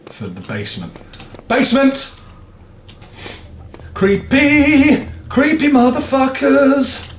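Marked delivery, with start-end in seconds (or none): 1.5-7.1 scared shout. Real, not acted. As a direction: yell it out to fight the fear. Shouting at creepy basement.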